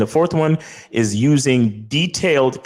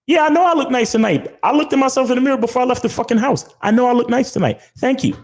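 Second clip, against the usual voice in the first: high-pitched mock woman, brushing it off; dismissive woman's retort; 13 semitones higher, faster than usual. Date answering a stock compliment, dismissive high mock-female voice. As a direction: pitch way up and rattle off her unimpressed comeback.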